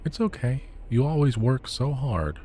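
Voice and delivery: put-on high, comforting child's voice; relieved little boy. A narrator's reading. Boy forgives his father for forgetting, relieved child voice. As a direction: forgive Papa gladly.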